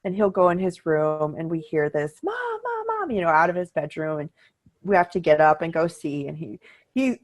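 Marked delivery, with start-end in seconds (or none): 2.2-3.1 high calling voice. Retold or imitated. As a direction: call out like a kid from another room. Son calling from bedroom.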